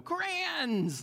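put-on high, delighted child's squeal of discovery; childlike cry of joy; excited kid. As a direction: gasp it out like a thrilled five-year-old. Kid excited over backpack gift.

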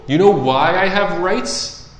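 high-pitched rhetorical demand; emphatic challenge. Acted. Jesus asserts his rights to the scroll, challenging emphatically. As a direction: challenge them with rising questions.

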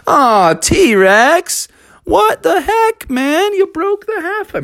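high-pitched, whining reproach; acted squealing protest; dismayed complaint. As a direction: scold a friend who wrecked the fun. Friends scold T-Rex for breakage.

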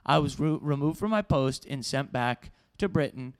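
ordinary delivery

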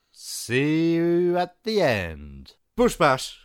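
catchphrase sign-off. Pretend. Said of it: "That's my new catchphrase".

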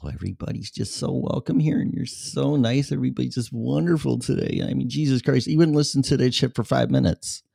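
lightly mocking a nice sermon voice; mock church sermon. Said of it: "like this is a church sermon".

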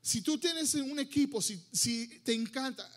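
usual speaking voice

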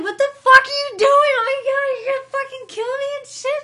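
shrieking high and very loud in mock terror; retelling being blindfolded, she shrieks in panic; panicked scream. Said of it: "And I was screaming".

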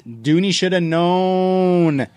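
high, drawn-out mock holler; all-caps outburst. Host reads a listener's all-caps complaint, mock holler. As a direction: stretch it out high like text in capitals. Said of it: "he also said in all caps".

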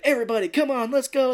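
high, loud call to the crew; hammed-up boss rallying everyone; rallying shout. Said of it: "She's like".

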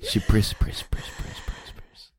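rapid whispered repetition, dying away; playful whisper. Pretend. Co-host repeating a silly word to the group, whispered and fading.

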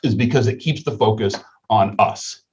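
ordinary talk, nothing marked

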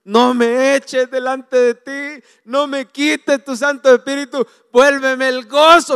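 slow, high plea to God; tearful pleading. Retold or imitated. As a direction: cry out for the lost joy. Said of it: "imagínenselo llorando".

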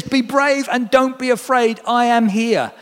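high, slow reassuring call; slow, high words of comfort; Jesus calling out. A narrator's reading. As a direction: draw it out to calm them. Jesus voiced again, slow.

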